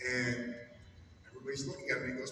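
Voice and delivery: usual speaking voice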